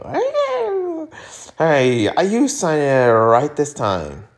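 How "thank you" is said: high character thanks. Acted. Character voice after laugh.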